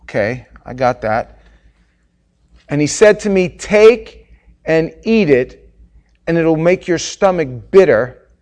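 loud, insistent divine command; commanding God voice. Real, not acted. God the Father sending John to the angel, booming. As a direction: order him to go, booming. Preaching at full force.

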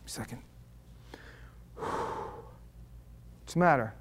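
sigh, then a soft hushed question; whispered reply. Retold or imitated. Whispering to a teary friend, sighing gently. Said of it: "and I'll be like".

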